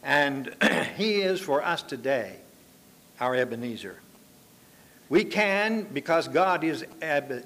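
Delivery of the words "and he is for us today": usual speaking voice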